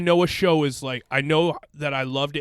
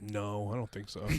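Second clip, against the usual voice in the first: mumbled; 8 semitones lower, 17 dB quieter than usual. Retold or imitated. Retold own dismissive reply.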